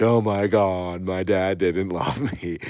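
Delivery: hammed-up heartbroken whine; mock wailing. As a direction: pretend to bawl about it. Acting out upset viewers.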